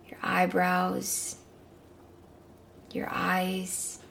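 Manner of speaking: calm guided meditation. Acted.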